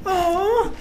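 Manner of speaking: high-pitched imitated moan; mock moan. Imitating a coworker pranking a scam caller, mock moan. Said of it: "He keeps fucking moaning".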